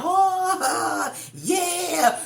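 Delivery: loud, high and laughing; excited laugh. Real, not acted. Picturing someone zip lining, loud excited laugh. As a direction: burst out with delight.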